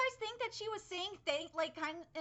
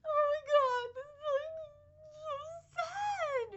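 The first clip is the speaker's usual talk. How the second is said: much higher, louder, tear-choked voice; crying; 11 semitones higher, 6 dB louder than usual. Real, not acted. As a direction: sob through the words, heartbroken. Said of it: "wipe a couple tears out of my eyes".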